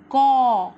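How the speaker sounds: loud, clear model of गौ with an open au; syllable demo. Shown as a demonstration. Teacher modelling गौ loudly for kids. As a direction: belt out गौ for the class. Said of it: "इसे किस तरह से पढ़ा जाएगा?".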